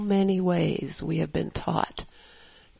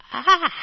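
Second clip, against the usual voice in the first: short high, loud "ah" of dismay; acted yelp of horrified realization; dismayed cry; 11 semitones higher, 6 dB louder than usual. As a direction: cry out as if caught out. Reacts to discovering own jealousy.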